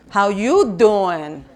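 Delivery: slow, punchy New York catchphrase; New York accent. Pretend. Speaker repeats the joke to the audience, broad New York accent. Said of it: "I was from New York".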